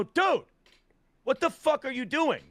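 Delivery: yelling at a buddy caught with drugs on the counter; retold angry confrontation. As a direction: snap at him in disbelief. Confronting friend over drugs at home.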